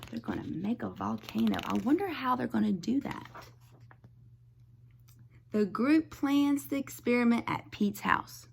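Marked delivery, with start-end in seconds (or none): none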